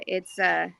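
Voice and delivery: ordinary talk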